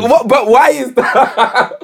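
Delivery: laughing disbelief. Real, not acted.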